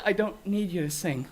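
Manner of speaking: ordinary talk, nothing marked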